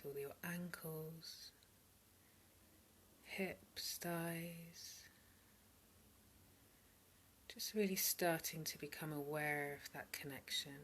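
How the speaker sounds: calm meditation voice, spacious pauses between phrases; guided meditation. Real, not acted. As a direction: softly lead listeners into body and breath. Leads a meditation.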